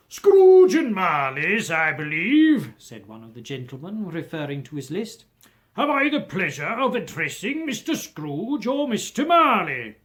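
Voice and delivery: lightly voiced portly gentleman. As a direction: greet him with polite formality. Gentleman addresses Scrooge.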